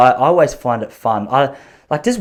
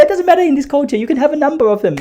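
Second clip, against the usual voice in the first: put-on calling older woman; 13 semitones higher than usual. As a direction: holler it as a pushy old lady, much higher.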